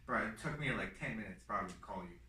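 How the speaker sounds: low, soft, deflated aside; subdued dread. Real, not acted. Telling his brother about dreading the call, hushed and low. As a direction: say it quietly, drained.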